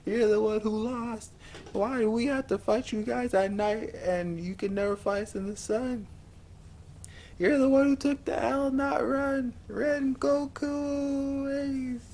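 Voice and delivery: high, strained mock yell; yelling Tanjiro. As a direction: plead and shout like a desperate boy. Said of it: "Tanjiro was yelling".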